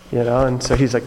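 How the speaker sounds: usual speaking voice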